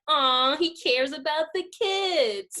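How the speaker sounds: high, drawn-out coo; touched, hammed-up swoon; gushing aww. Mimics viewer's gushing reaction to prince.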